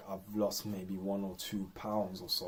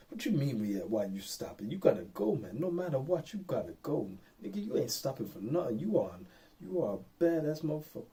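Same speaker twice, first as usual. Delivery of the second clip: hype coach voice; 6 semitones higher than usual. Retold or imitated. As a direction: fire him up to keep running. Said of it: "this little dialogue going in my head".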